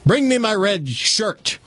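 commanding captain calling out, high and slow; captain's order. Acted. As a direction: bark the order like a proud captain. Captain ordering first mate.

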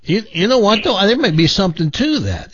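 ordinary talk, nothing marked

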